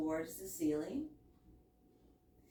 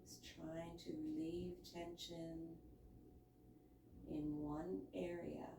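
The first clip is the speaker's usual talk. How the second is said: hushed guided relaxation voice, much softer; soft spa whisper; 2 semitones lower, 14 dB quieter than usual. Acted. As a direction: soothe them into stillness, very softly. Guides relaxation in yoga pose.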